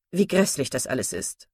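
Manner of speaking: sour, disgruntled gentleman; grumbling complaint. Acted. Mr Palmer complaining about the weather, lightly voiced grumbling. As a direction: grumble with weary disgust. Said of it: "über das Wetter zu klagen".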